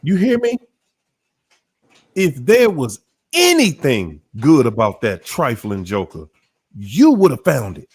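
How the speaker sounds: punched, high-swinging preacher cadence; emphatic preaching. Acted. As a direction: drive it home like a preacher, with dramatic pauses. Preaches the point home.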